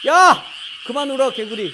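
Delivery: loud, high "hey" easing a little; high, loud call-out in mock exasperation; scolding shout. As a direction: yell at noisy frogs to stop. Yelling at croaking frogs.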